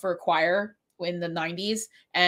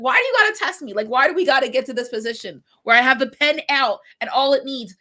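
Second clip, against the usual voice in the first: much higher, louder exasperated scold; 4 semitones higher, 5 dB louder than usual. Retold or imitated. Teacher scolding a misbehaving student, high and exasperated. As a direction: demand why he keeps testing her.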